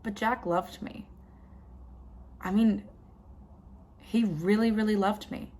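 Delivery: soft, subdued remorse; quiet, pained confession with long pauses; regretful. Acted. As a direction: pull the voice back, halting with regret. Regrets hurting the man who loved her.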